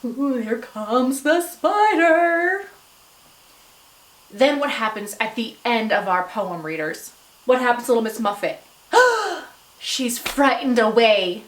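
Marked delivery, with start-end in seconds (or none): 0.0-2.7 slow, climbing spooky dread; fearful anticipation. Shown as a demonstration. Teacher shows readers the spider is coming, fearful. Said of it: "I changed my voice to show". 8.9-9.4 high, sharp gasp of fright; frightened gasp. Acted. Teacher acts Miss Muffet frightened by spider, gasping.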